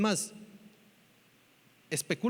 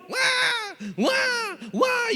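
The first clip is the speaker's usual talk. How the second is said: very high, piercing mock baby cry; imitated baby wail; much slower than usual. Imitates a first child crying. (llanto de bebé imitado)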